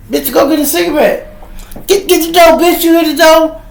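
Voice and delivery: high, loud, fast yelled orders at his sister; brother yelling orders. Retold or imitated.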